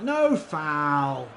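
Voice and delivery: shouted insistent no foul; loud protest. Real, not acted. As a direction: hammer it at the referee. Protesting referee call.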